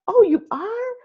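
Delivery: high, rising "Oh, you are?" in put-on amazement; mock surprise. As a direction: play it stunned but polite. Feigned surprise at friend's news.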